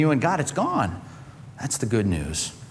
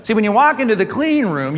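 usual speaking voice

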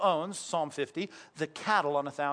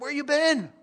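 much higher, louder call of where you been; surprised call; 10 semitones higher, 5 dB louder than usual. Retold or imitated. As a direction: call out to the son coming back. Father asks returning son.

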